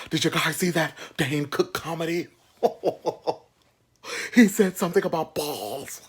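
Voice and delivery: high, dopey bro voice; mock of housemates' dumb comedy chat; mocking roommates. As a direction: ham up the clueless roommates.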